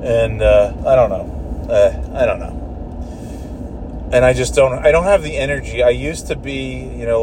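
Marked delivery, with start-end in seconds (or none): 1.7-4.1 slow, breathless trailing whisper; weary whisper. Real, not acted. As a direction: let it fade out, defeated. Weary repeat.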